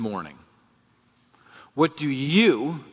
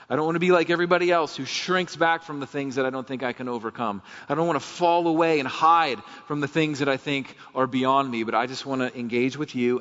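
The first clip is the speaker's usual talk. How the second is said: ordinary talk, nothing marked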